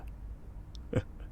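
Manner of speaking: breathless, hushed chuckle; a quiet amused laugh under the breath; soft villain chuckle. Acted. Tied-up villain chuckling at the tense hero, hushed laugh.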